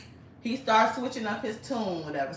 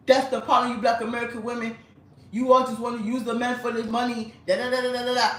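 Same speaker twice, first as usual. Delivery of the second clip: mocking the man's rant; 3 semitones higher, 4 dB louder than usual. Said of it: "he pulling out all these talking points".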